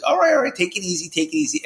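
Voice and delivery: high, soothing take it easy; calming someone down. Retold or imitated. Friend calming a wound-up Adam, soothing. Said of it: "And I heard Tony, he goes".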